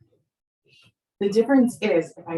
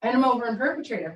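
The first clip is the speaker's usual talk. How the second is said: usual speaking voice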